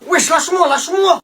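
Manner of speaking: high, loud protest; protesting struggle. Acted. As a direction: yell while trying to break free. Struggle, demands to be released.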